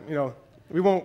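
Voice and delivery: ordinary talk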